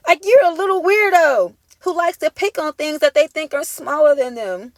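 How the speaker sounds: mocking scorn. Real, not acted. Mocking the abuser as a gnome.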